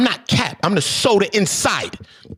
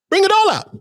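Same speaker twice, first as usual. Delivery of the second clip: hyped shout; 14 semitones higher, 4 dB louder than usual. Real, not acted. Ranting, hyped up.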